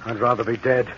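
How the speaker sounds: quiet, low, hopeless muttering; despairing. Acted. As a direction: give up, low and soft. Castaway in despair on the island.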